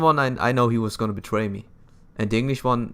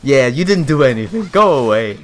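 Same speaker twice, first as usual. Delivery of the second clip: louder, mock-scolding a lazy ally; loud teasing; 4 semitones higher, 9 dB louder than usual. Real, not acted. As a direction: shoo him off loudly. Scolding useless game ally.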